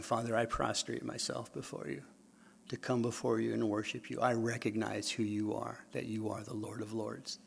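soft, humble prayer voice; softened prayer. Shown as a demonstration. Preacher showing his prayer, quiet and humble. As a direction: pray it gently, head bowed.

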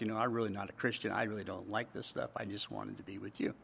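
low, very soft admission; acting out a subdued confession; imitated confession. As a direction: confess it quietly, voice sinking. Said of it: "he finally confessed".

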